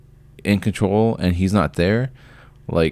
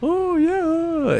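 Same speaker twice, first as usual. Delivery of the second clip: very high, airy mock "oh yeah"; mocking the careless owner. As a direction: toss it off like a clueless shrug, pitched way up. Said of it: "his whole fucking".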